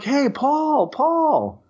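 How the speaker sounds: high-pitched sing-out of a name; imitated calling out. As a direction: call to an old pupil, imitating an excited teacher. Teacher calling him over, retold.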